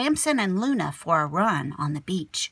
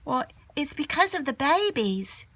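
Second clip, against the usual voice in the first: put-on girl's voice; 8 semitones higher than usual. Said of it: "said a voice".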